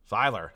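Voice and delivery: SEILER said as "SIGH-ler", settling on it; "Seiler" with a long "eye" vowel, falling at the end; corrected name pronunciation. Shown as a demonstration. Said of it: "I think that's probably it".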